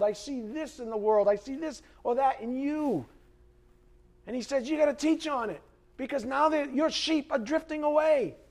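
high, driving preacher's cry; preaching at full force. Acted. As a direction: pound the point home from the pulpit.

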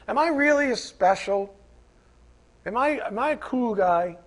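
mock a guy begging friends for reassurance; lightly whiny self-doubting caller; needy insecure voice. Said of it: "Guys don't talk like this".

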